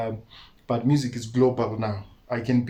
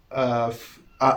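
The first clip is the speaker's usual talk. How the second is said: ordinary talk, nothing marked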